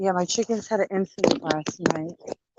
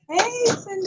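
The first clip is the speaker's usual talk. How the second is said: bright greeting; 12 semitones higher, 3 dB louder than usual. Real, not acted. Calling a greeting to neighbor.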